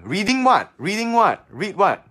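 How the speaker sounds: high puzzled repeat; surprised. Real, not acted. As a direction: sound baffled by the question. Puzzled at viewer's question.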